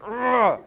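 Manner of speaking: high exasperated "ugh"; frustrated groan. Retold or imitated. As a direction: groan out your bitterness. Bitter while driving.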